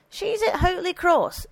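high-pitched, emphatic character voice; indignant housekeeper. A narrator's reading. Housekeeper answering the priest about her rival, indignant. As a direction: answer sharply, pitched well up.

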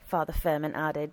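usual speaking voice

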